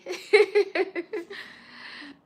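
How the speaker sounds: high, loud burst of laughter. Real, not acted. Vlogger laughing loudly at her own greeting.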